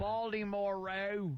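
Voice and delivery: high, slow Baltimore drawl on row; hammed-up Baltimore accent with a high, slow Baltimore row. Shown as a demonstration. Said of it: "Baltimore accent".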